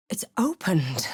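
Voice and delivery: ordinary talk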